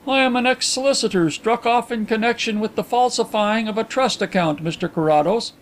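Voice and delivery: high-pitched, formal visitor; formal confession. A narrator's reading. Visitor rises to confess his disgrace, formal. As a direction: state the disgrace with stiff formality.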